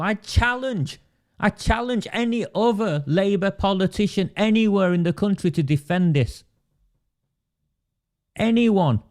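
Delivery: high-pitched, impassioned challenge; indignant challenge. Real, not acted. Impassioned public challenge to rival politicians.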